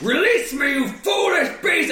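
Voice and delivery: high furious shrieking rage; raging scream. Acted. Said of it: "Marwyn is just screaming in rage".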